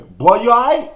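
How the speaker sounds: high, loud call of a startled uncle. Retold or imitated. Uncle yells across the yard to the boy who fell, high and loud.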